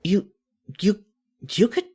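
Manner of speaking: normal talking voice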